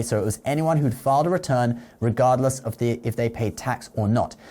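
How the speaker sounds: ordinary talk, nothing marked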